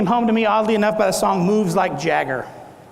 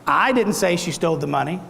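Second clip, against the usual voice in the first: "I" stressed at the start, the rest tailing lower; 3 semitones lower than usual. Shown as a demonstration. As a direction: hit the first word "I", as if someone else said it. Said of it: "say that several different ways".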